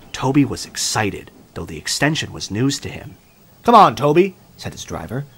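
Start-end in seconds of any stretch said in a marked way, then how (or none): 3.6-4.3 louder, higher, upbeat urging; hearty call. A narrator's reading. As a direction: call out brightly to get someone moving. Driver cheerily urging Toby along.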